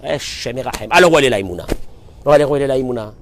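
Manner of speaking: normal talking voice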